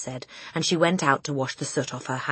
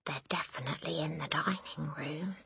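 hushed, breath-only whisper; 13 dB quieter than usual. A narrator's reading. Matilda warns the family of intruders, whispering. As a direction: whisper it secretly. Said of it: "Matilda whispered".

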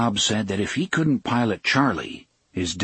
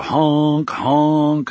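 loud, drawn-out honks; honking horn imitation; 5 semitones higher, 5 dB louder than usual. A narrator's reading. Narrator tells of the Burlington Zephyr's horn, honking loudly. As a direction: blast the words out like a train horn.

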